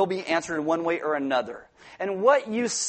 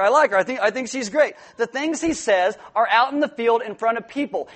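usual speaking voice

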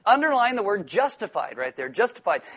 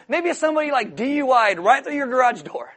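usual speaking voice

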